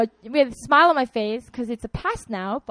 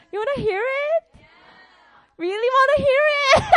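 teasing; 13 semitones higher, 6 dB louder than usual. Real, not acted. Teasing the audience.

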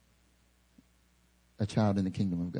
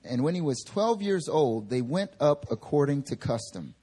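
higher, measured reading voice; scripture reading; 6 semitones higher than usual. Acted. Preacher reading Luke aloud to the church, measured reading. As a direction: read the passage to the congregation.

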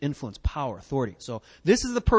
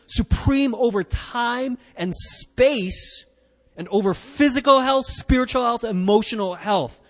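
higher, louder fervent confession; fervent centurion; 6 semitones higher, 5 dB louder than usual. Acted. Preacher voicing the centurion's faith to Jesus, fervent and loud. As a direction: profess it with rising conviction. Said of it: "he's saying this".

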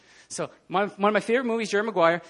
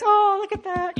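high, gushing mock movie coo; sappy Hollywood scene; 13 semitones higher, 6 dB louder, slower than usual. Preacher mocking a nice Hollywood scene, high sappy coo. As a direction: coo it like a sugary film moment. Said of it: "you know, like Hollywood".

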